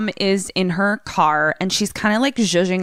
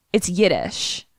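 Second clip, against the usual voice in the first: ordinary talk, nothing marked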